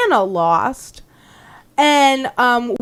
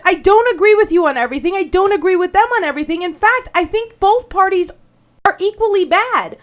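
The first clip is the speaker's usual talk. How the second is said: higher-pitched, mildly exasperated retort; firm insistence; 8 semitones higher than usual. Retold or imitated. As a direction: retell standing your ground, pitched up. Retells arguing back at caller.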